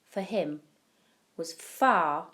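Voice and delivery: ordinary delivery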